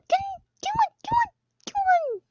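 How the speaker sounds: very high squeaky commands; urgent urging. Real, not acted. Player urging teammates to get a mask. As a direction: push them to grab one.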